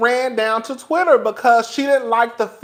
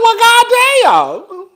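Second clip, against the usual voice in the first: mock exasperated sigh; 9 semitones higher, 3 dB louder, much slower than usual. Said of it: "I said".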